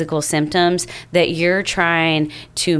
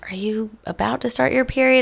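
imitating boyfriend; 5 semitones higher, faster than usual. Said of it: "He's like".

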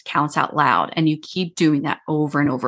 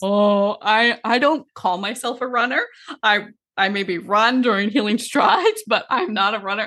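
higher-pitched, hesitant self-quote; reluctant protest; 4 semitones higher than usual. Retold or imitated. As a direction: act out your own mild protest, pitched up. Retelling her reluctance to coach.

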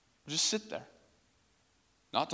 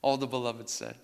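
closing prayer; 3 dB louder than usual. Acted.

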